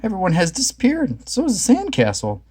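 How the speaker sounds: high worried voice; rising alarm; worried Shaggy. A narrator's reading. Shaggy noticing the gang gone, high and worried. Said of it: "said Shaggy".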